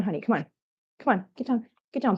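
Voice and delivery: ordinary delivery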